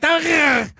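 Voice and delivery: high, mock shriek of the wife; mock scream. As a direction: squeal like the panicked wife.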